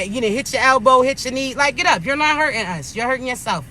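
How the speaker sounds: ordinary delivery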